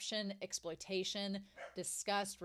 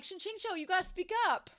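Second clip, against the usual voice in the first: pleading urge; 8 semitones higher, faster than usual. Retold or imitated. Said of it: "and I'm like".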